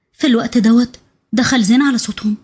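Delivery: usual speaking voice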